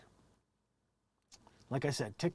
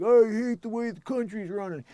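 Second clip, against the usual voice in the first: higher, louder mock complainer; mocking angry citizen; 8 semitones higher, 8 dB louder than usual. Imitating an angry person online, mock complaining. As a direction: ham up an angry voter. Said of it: "I just hear other people like".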